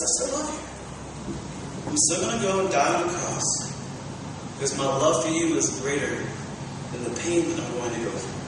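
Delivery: tender assurance. Acted. Jesus to the friend who betrays him, hushed tender love. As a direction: assure a betrayer quietly, voice dropping low.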